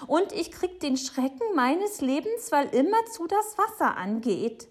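high, loud, agitated complaint; agitated Frau Segebrecht. Acted. Neighbour telling Papa about her scare, agitated and high. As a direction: play an alarmed woman complaining.

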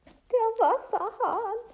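very high, slow and loud; overwrought, stretched-out cry; distraught woman. Acted. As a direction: draw it out high, full of dread. Distressed woman begins her account.

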